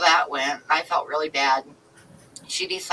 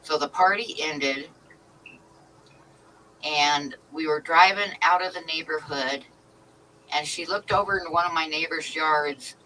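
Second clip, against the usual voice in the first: ordinary delivery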